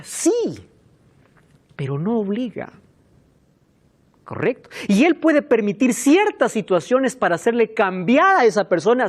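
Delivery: ordinary talk, nothing marked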